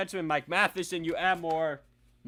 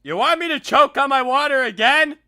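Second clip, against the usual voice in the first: taunting shout; 7 semitones higher, 12 dB louder than usual. Acted. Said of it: "another impersonation".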